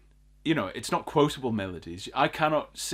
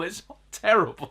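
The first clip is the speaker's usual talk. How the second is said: ordinary talk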